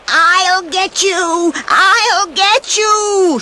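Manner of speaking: a wicked crone shrieking threats down from the ledge; witch screech. Acted. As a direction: screech it out like a furious old witch. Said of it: "she screeched at the dwarfs".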